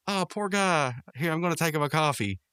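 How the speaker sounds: high-pitched imitated female character; Eileen. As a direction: pitch it well up, acting out her sympathy. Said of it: "now Eileen goes".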